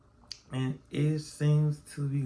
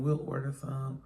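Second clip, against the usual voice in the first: usual speaking voice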